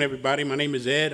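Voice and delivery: normal talking voice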